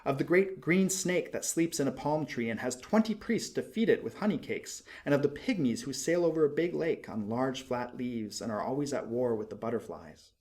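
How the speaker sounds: ordinary delivery, nothing marked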